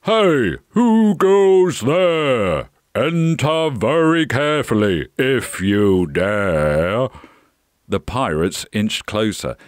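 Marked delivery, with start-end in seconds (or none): 0.0-7.1 slow, booming giant challenge; growling giant. A narrator's reading. Giant challenges pirates at his cave, slow growl. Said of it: "growled a giant".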